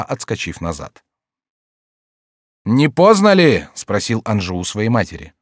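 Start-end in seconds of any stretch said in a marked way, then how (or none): 2.6-3.7 high, loud, drawn-out asking; urgent question. A narrator's reading. Anjou asking his mother if late, urgent. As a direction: call the question out.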